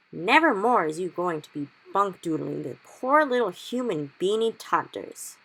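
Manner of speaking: a hint of the giant's righteous scolding; stern giant. BFG addresses nightmare.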